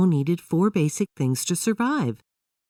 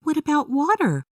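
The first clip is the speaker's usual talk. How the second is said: Emily's voice; 13 semitones higher than usual. A narrator's reading. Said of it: "Emily asked".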